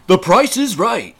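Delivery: slow, loud, high announcer call; game show announcer. Pretend. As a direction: hype it like a game show host.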